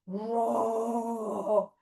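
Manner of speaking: long, steady roar; big play-bear roar; forest bear roar. Pretend. Teacher plays the forest bear, long roar. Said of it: "we found the forest bear".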